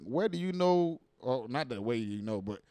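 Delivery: normal talking voice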